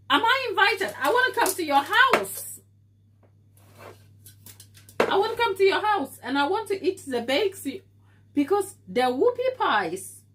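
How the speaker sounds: high, loud and eager; excited. Real, not acted. Streamer begs a viewer to invite her over, high and excited. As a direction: beg to be invited, giddy with excitement.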